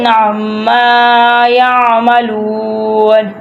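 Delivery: slow, measured tajweed recitation with repeats; Quran recitation. Shown as a demonstration. Teacher recites verse for students to follow, measured chant. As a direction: chant each phrase, then repeat it.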